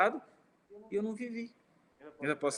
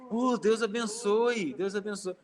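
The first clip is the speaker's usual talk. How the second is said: higher, drawn-out happy blessing; delighted reply; 5 semitones higher, slower than usual. Retold or imitated. Tense women smile and bless the speaker back, delighted. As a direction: imitate grateful surprise, easing off on the repeat. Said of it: "deram um sorriso".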